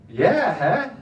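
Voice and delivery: loud bluffing yeah huh with a laugh; fake understanding. Friend's advice for bluffing through Dutch talk, loud laugh. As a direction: nod along without a clue. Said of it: "is just say".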